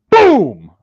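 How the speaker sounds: huge, high-pitched imitated gunshot boom; explosive boom. Recruit's rifle goes off unexpectedly, booming imitation of the shot. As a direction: boom it out like a gun going off.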